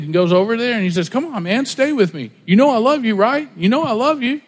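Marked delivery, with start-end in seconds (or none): 1.1-4.4 imitated pastor, higher-pitched appeal; coaxing pastor. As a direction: mimic a preacher working on one listener, pitched up. Imitating pastor pleading with a sleeper.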